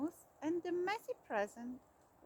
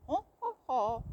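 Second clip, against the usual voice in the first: Santa ho ho ho; 6 dB louder than usual. A narrator's reading. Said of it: "cried the little Santa Claus".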